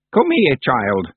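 put-on slow, high commanding old witch; stern old witch giving orders; ordering old witch. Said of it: "she ordered".

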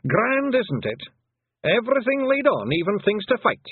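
delighted wee warrior savouring the fight; happy pixie. A narrator's reading. Rob Anybody delighted by monsters to fight, high beaming Scots pixie. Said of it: "said Rob, beaming happily".

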